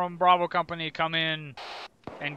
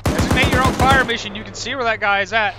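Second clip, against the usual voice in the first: shouting orders; 4 semitones higher, 10 dB louder than usual. Real, not acted. Commander yelling orders in firefight.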